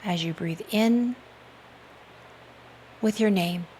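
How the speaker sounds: calm, measured hypnotic induction voice; guided meditation. Real, not acted. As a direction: guide the listener into relaxation with unhurried pauses. Leads breath-focused guided meditation.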